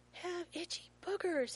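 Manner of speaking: high, loud, slow holler; child's yell. A narrator's reading. Small son walking into store with dad, gleeful yell. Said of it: "he yells as they walk into Target".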